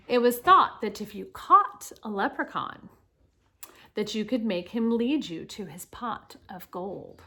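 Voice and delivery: projected, lilting read-aloud with punched openings; reading to children. Acted. Teacher reads Saint Patrick's life story to children, animated read-aloud. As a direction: read clearly to the class, lifting key words.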